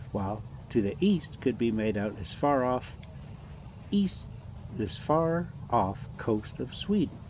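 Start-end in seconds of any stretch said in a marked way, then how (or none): none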